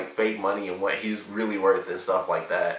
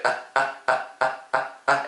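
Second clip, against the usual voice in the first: mock looped laugh, same short ah over and over, a bit higher; imitating a looped laugh; 5 semitones higher than usual. Vlogger mimics a looped sitcom laugh track, a bit higher, mock repetitive. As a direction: copy the laugh that plays on repeat. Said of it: "like, this laugh".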